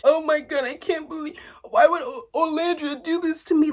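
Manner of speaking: high, whiny fake sobbing voice; imitating Huda's sudden tears, hammed-up whine; mock crying. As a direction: parody her tearful outburst. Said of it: "Starts crying out of nowhere".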